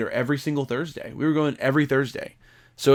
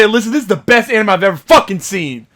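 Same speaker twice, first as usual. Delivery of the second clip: excited gush; 5 semitones higher, 5 dB louder than usual. Retold or imitated. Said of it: "I'd say".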